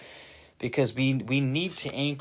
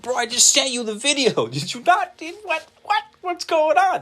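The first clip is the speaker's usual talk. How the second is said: much higher and louder, disbelieving bro-yell; exasperated shout; 13 semitones higher, 9 dB louder than usual. Retold or imitated. Retelling his voice message to a friend who ignored the video, exasperated high yell. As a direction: yell it like you can't believe he missed the video.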